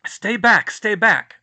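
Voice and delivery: loud, high command to keep away; yelling. Acted. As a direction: bark the warning at the crowd. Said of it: "someone yelled".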